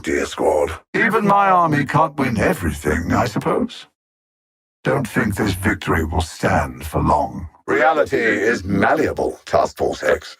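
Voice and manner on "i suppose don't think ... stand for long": normal talking voice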